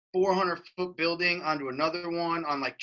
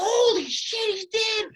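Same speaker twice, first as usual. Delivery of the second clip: high, loud shocked shout; mock crowd shout; 4 dB louder, slower than usual. Crowd seeing the rider crash, loud high shout. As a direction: yell it like the crowd watching the crash. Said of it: "Everybody's like".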